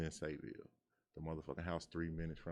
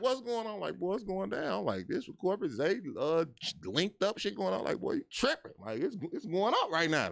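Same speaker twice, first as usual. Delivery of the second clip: much higher, louder hyped-up exclaiming; excited brother; 14 semitones higher, 8 dB louder than usual. Retold or imitated. Brother learning what's going on outside, loud and hyped. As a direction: hype it up like the brother in prison. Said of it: "he like".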